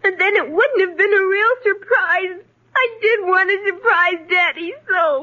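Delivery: put-on plaintive tearful child; crying. Girl whose surprise for Daddy is ruined, crying. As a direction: wail the explanation to the end. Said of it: "please stop crying".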